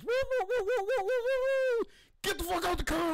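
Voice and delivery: high siren wail then barked order; mock police siren. Imagining the police stop, high mock siren. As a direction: play the squad car pulling you over.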